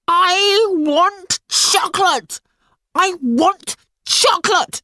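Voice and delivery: high, loud bawled demand; bawling tantrum. Acted. Red-faced girl in a tantrum, bawling. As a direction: scream it like a child in a tantrum. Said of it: "bawled the girl".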